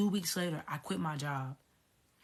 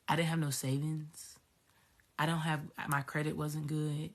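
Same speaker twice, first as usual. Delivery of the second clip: ordinary delivery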